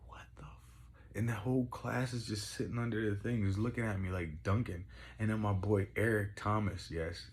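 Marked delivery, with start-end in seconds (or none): 0.1-1.1 slow, whispered mock astonishment; stunned whisper. Coach watching a kid dunk, imitated stunned whisper. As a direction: whisper it slowly, imitating disbelief. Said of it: "and he's like".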